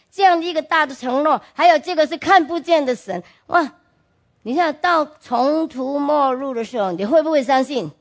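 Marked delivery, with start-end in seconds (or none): none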